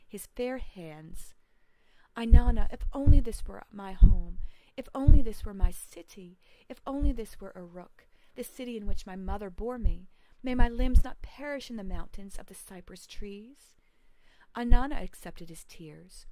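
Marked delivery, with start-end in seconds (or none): 2.2-13.8 much louder, crying out a longing prayer; loud impassioned plea. Acted. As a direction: call out to the goddess for home with full force. Lugalbanda pleads to Ainana.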